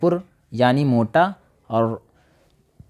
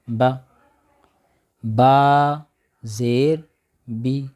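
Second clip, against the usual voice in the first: drill recitation; much slower than usual. Shown as a demonstration. Spelling drill.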